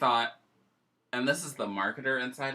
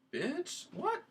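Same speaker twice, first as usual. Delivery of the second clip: high, incredulous mock shock; stunned disbelief; 7 dB quieter than usual. Owner spotting her own dog on a sign, high shocked disbelief. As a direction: squeal it like you can't believe your eyes.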